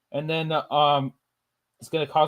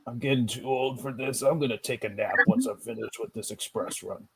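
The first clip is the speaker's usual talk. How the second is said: softer, low-energy tired character voice; weary old-timer; 3 semitones lower, 9 dB quieter than usual. Pretend. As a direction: play a worn-out old engine. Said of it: "And then he's like".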